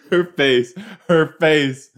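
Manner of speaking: loud, high cry through laughter; laughing outburst. Real, not acted. Viewer cracking up at a character's face, loud and high. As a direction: blurt it out while cracking up.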